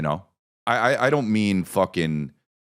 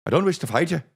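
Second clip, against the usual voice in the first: a light, high Irish lilt on wish; Irish accent; 9 semitones higher, much faster than usual. Retold or imitated. Comic quoting Far and Away, in a light Irish accent. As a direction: quote Tom Cruise in a mild Irish brogue. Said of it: "I'm talking about that".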